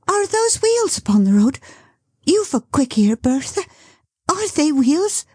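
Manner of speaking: high, eager question; listen hard for the wheels. Acted. Dot hearing wheels on the road, high and eager. Bertha asks, Dot answers.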